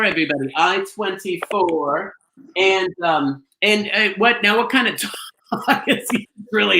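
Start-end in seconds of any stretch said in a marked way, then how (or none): 0.6-2.1 drawn-out bingo call. Acted.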